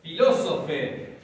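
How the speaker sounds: Filósofe said slowly, stress on the first o; slow demo pronunciation. Shown as a demonstration. Teacher models Filósofe slowly for students. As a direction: sound out Filósofe slowly for the class. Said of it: "el acento por ahora".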